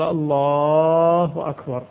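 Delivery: الله with the lam stretched long, slow; slow elongated الله أكبر demonstration; drawn-out Allah. Shown as a demonstration. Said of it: "تنعقد إن مد اللام".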